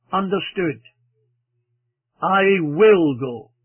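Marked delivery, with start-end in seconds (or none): 2.2-3.5 slow, deliberate, with a loud, weighty "will"; stress on WILL. Shown as a demonstration. Preacher showing a deliberate, understood decision, stress on "will". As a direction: say it slowly and press firmly on "will". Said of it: "laying the emphasis on the will".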